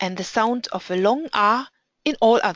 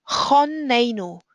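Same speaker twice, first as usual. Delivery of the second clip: Chonenu stressed on the middle "ne", said ay; slow "Chonenu", unstressed closed "chon", long stressed "ne"; 2 semitones higher, slower than usual. Shown as a demonstration. Teacher modelling a closed unstressed syllable, stress on the ne of Chonenu. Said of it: "The stress is on the A".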